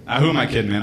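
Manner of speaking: ordinary delivery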